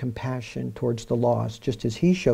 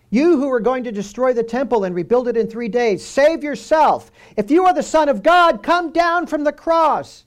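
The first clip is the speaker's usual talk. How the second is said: higher, louder jeering call; mocking taunt; 11 semitones higher, 6 dB louder than usual. A narrator's reading. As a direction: sneer it like a taunting crowd at the cross. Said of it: "hurling abuse at him, wagging their heads, and saying".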